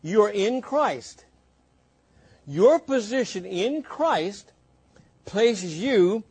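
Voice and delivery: slow, deliberate teaching voice; careful unhurried voice. Shown as a demonstration. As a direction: lay each word down slowly. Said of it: "follow me carefully".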